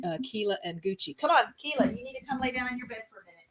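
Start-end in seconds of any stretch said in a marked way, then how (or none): 1.2-3.4 real off-mic aside, much softer and quick; getting on to dogs. Real, not acted. Host telling the dogs to lie down, softly chiding. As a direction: coax and chide pets, voice turned away. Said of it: "This is me getting on to".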